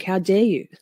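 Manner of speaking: normal talking voice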